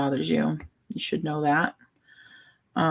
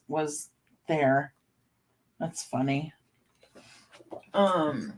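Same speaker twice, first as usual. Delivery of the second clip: ordinary talk, nothing marked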